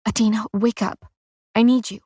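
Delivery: understated urgent pleading; mildly desperate plea to wake someone; pleading whisper. A narrator's reading. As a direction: beg gently, not fully whispered. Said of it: "A pleading whisper trips off my tongue".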